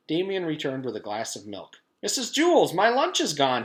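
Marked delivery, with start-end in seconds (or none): 2.0-3.6 high, loud call for the teacher. Acted. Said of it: "he called".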